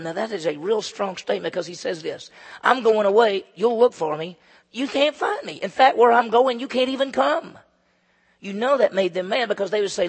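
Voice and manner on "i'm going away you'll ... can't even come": Jesus' words proclaimed. Acted. Pastor voicing Jesus to the religious leaders, solemn and loud. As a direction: declare it like a prophecy.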